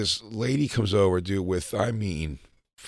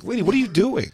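high-pitched retold exasperation; exasperated challenge; 12 semitones higher than usual. Fan questioning the security lady, retold exasperated challenge. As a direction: question her in reenacted disbelief.

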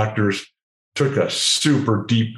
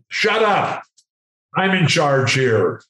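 gruff coach; 5 semitones higher, slower than usual. Retold or imitated. Coach silences doctors.

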